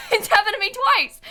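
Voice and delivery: high, laughing through real upset; tearful laughing. Real, not acted. Streamer telling chat of a repeated bad experience, tearful laughing. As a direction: laugh helplessly on the edge of tears.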